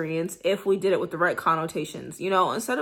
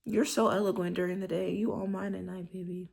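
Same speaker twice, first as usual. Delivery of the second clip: much softer, intimate lover's voice; soft seductive tone; 6 dB quieter than usual. Shown as a demonstration. Showing possessive praise for a lover. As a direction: purr it to a partner. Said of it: "You could just be like".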